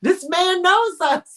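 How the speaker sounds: delighted exclamation through laughter; laughing delight. Retold or imitated. Telling the host about the shopkeeper, laughing and high.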